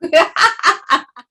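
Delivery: bright burst of laughter; high laugh. Real, not acted. Laughter meditation.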